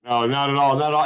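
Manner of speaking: ordinary talk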